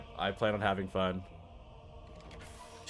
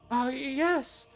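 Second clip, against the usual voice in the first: put-on high old lady voice; a dreamy elderly noblewoman; 11 semitones higher than usual. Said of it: "my old lady voice".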